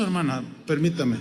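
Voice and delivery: soft, low, patient request; polite restraint. Retold or imitated. Pastor stops the wife interrupting, low and patient. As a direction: gently hold off an interruption.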